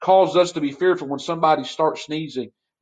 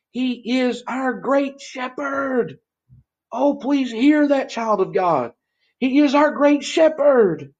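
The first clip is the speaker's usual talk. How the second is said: slow, higher, drawn-out preaching; preaching climax; 9 semitones higher, slower than usual. Acted. Pastor pleading with child of God, slow preaching. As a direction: lean on great shepherd.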